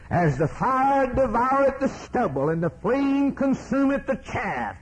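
high, sustained pulpit cry; fiery preaching. Real, not acted. Preacher repeats the burning stubble verse, full-force preaching. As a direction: ring the verse out high over the congregation.

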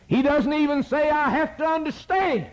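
preacher's high cry. Real, not acted. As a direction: ring it out to the back pew. Preacher shouting the point.

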